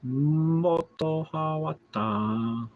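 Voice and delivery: exaggerated British accent, high and slow; mock British pronunciation. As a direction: stretch it out slowly in mock British. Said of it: "It's the way they pronounce it".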